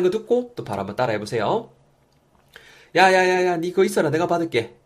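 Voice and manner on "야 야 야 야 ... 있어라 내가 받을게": higher, louder call to someone nearby. Acted. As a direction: call out quickly to stop them getting up. Acts out offering to answer phone.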